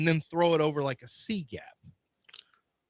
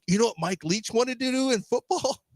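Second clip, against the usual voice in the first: mock John impression; 6 semitones higher than usual. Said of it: "and now he's just like".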